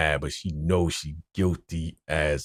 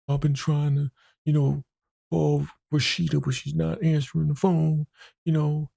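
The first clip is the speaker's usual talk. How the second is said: much higher, teary mock voice; high upset Geneva; 11 semitones higher than usual. Geneva tells the pastor why she's crying, high teary voice. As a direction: sound distressed, pitched high.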